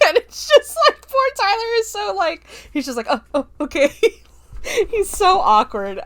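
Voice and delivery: high real laughter over the words; squealing real laughter; cracking up. Real, not acted. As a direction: laugh helplessly while telling it. Narrator cracking up at scene.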